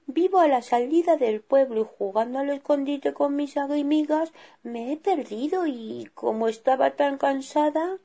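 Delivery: Esmeralda explaining to the bears; small girl's explanation, high; little girl voice. Acted.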